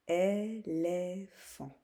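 "éléphant" very slow, in three beats; syllable by syllable. Shown as a demonstration. Teacher naming the fourth picture, syllable by syllable. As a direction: stretch "é, lé, phant" apart. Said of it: "la deuxième ou la troisième syllabe".

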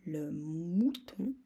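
le mouton drawn out very slowly, syllable by syllable; slow repeat. Shown as a demonstration. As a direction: stretch le mouton out for dictation. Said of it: "répétition lente du mot". (le mouton très lentement)

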